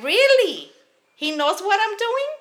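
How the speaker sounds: high, loud mock disbelief; retold incredulous challenge. Acting out her reply to boss.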